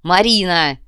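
loud, forceful shout of the name; loud, sharp summons; angry call. A narrator's reading. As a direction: shout to shake her awake. Milana shakes the girl, shouting.

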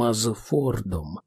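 usual speaking voice

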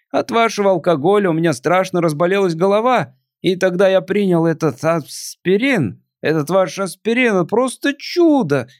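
put-on a melancholy drunk visitor; tipsy alien voice.

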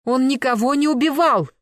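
high, loud protest; outraged yell. Acted. Protesting to the attackers that he killed nobody, outraged yell. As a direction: cry out in indignant defence. Outcry bringing girlfriend out of room.

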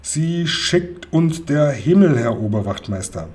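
plain reading voice

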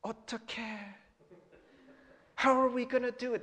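a hint of a pleading elder, mixing in Korean; lightly voiced plea of helplessness; mock-pleading elder; guilt-tripping church elder. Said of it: "They're like".

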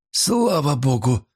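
high, slow, sighed-out words; exhaled relief. A narrator's reading. Pawnbroker hears he was not mentioned, exhaled relief. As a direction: breathe it out in relief. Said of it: "выдохнул процентщик".

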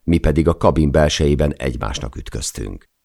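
ordinary delivery

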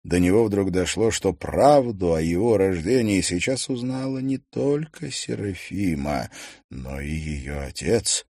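plain reading voice

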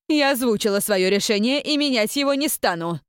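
high, loud, final command. A narrator's reading. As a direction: raise your voice to end it. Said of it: "Настоятельница повысила голос".

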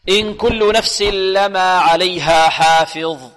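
loud, slow tajweed chant; Quran recitation. Shown as a demonstration. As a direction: project the verse with long held vowels. Teacher recites the verse in full.

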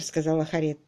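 ordinary delivery, nothing marked

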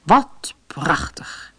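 slow, breathy whisper of wonder; hushed whisper. Acted. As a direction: breathe it out in awe, slowly. Toy elephant admiring the music.

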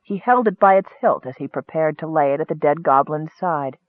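plain reading voice

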